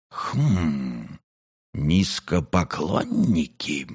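put-on creaky old storyteller. A narrator's reading. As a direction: drag it out, old and dry.

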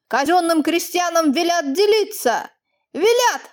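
blazing angry outburst; furious. Acted. Master shouts at the young peasant in fury. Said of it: "он страшно вспылил".

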